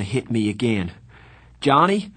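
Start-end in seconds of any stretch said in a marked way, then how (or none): 1.6-2.1 high, loud call. A narrator's reading. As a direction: call across the lot. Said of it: "I called".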